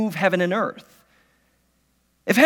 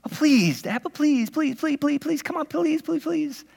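put-on higher, softer whiny child's pleading; kid begging; 5 semitones higher, 5 dB quieter than usual. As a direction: beg like a nine-year-old, rising and whining. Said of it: "And then the begging starts".

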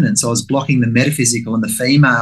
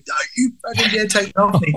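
mock fierce warning; 7 semitones higher than usual. Guarding chocolate from the healer.

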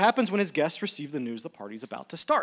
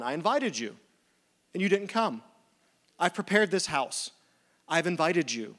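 aggrieved host; 3 semitones higher, 4 dB louder than usual. Acted. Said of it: "She goes".

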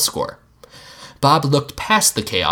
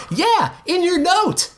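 put-on much higher, slow kid voice; kid shouting; slower than usual. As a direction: call it out like an excited boy. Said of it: "Jean shouted".

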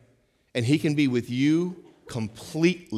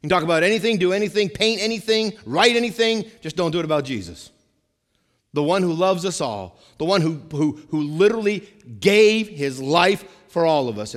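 rising preacher's list; 4 semitones higher, 5 dB louder than usual. Acted. Preacher climbing through a list.

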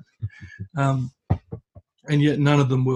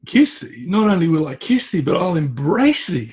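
higher, louder, gushing with delight; eager acceptance; 4 semitones higher, 4 dB louder than usual. Acted. Youngest son eagerly accepting the hag's kiss, delighted and loud. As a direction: throw yourself into it with glee.

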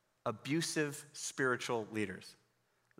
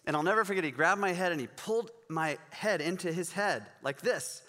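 ordinary talk

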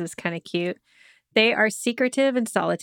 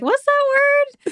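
high, drawn-out puzzled question; baffled reaction; 6 dB louder, slower than usual. Retold or imitated. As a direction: exaggerate your bafflement, pitched way up. Acting out own baffled reaction.

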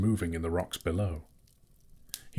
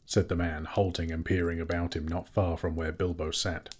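ordinary delivery

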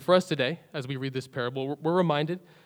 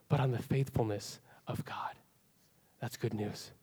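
whispered; 3 semitones lower, 8 dB quieter than usual. Real, not acted. Hushed contrast after preaching.

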